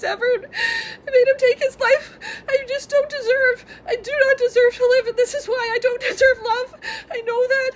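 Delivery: sobbing through the words, voice high and strained; real tears breaking the voice; crying. Real, not acted. Vlogger confessing guilt to viewers, crying. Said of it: "I can't seem to stop crying".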